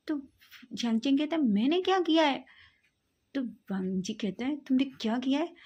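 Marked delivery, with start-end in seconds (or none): 1.4-2.5 higher, louder, indignant; outraged denial; indignant retort. Acted. Accused friend snaps back. 4.6-5.5 heated, accusing repeat; angry accusation. Acted.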